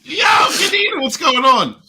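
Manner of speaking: high, loud hey-there greeting; shouted cheerful hello to Kanita; calling out greeting. Real, not acted. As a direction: call out to a friend across the room.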